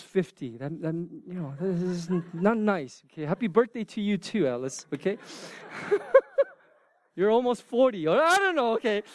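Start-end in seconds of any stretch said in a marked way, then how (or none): 5.2-6.4 high-pitched burst of laughter. Real, not acted. Laughing at birthday teasing.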